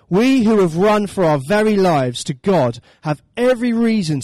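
loud, deliberate proclamation with weighted key words; declaimed Bible passage in a big preaching voice; emphatic scripture reading. Acted. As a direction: proclaim it to the whole congregation. Reads scripture emphatically to congregation.

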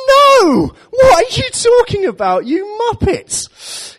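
a shrieking rebuke of foolish friends; exasperated outburst. Acted. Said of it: "hear Jesus' frustration".

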